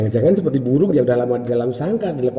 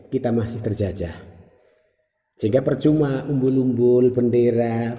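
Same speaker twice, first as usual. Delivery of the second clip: normal talking voice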